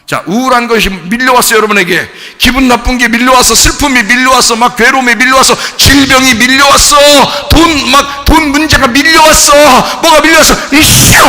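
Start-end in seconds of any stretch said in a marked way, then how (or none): 2.4-10.8 loud, high, escalating list; building shouted urgency; mounting onslaught. Acted. Troubles piling up, acted overwhelm. 10.8-11.2 high, loud burst of refusal; forceful acted yell; explosive rejection. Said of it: "이러란 말이죠".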